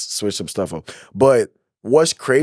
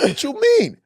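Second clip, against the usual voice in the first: much higher, startled question; alarmed surprise. Retold or imitated. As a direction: jolt up in alarm at the news. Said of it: "I said".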